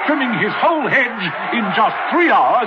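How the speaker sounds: fast, excited sports call; sports commentator. Acted. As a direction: call it like a live sports finish.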